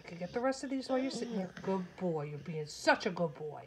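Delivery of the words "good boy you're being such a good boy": low, drawn-out cooing; doting pet talk; affectionate praise. Real, not acted.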